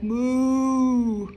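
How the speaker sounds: long loud mooing cow. Acted. Librarian reading aloud voices the cows mooing. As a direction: moo out like a cow.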